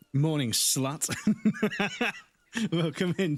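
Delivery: laughing. Real, not acted. Reading chat message, laughing.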